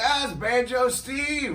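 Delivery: high, drawn-out shout; excited greeting shout. Retold or imitated. Retelling a fan's welcome in New Zealand, excited shout. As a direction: call it out like a thrilled announcer, stretched. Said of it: "Kevin would be like".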